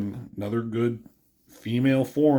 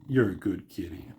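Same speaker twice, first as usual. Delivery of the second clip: soft cat talk; 4 semitones lower, 6 dB quieter than usual. Real, not acted. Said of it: "talking to his cat".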